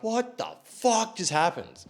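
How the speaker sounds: high, slow, stunned question; retold astonishment. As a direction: act dumbfounded, stretching it out high.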